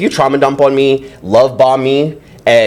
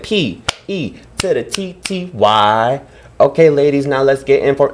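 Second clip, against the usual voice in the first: slow, drawn-out spelled chant; viral video catchphrase; 3 semitones higher, slower than usual. Retold or imitated. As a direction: stretch each letter like a hype man. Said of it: "If anybody remembers that one".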